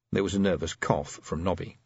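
ordinary delivery

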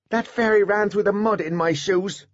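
put-on high child's voice, whiny; peeved little boy; complaining child. A narrator's reading. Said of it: "he complained".

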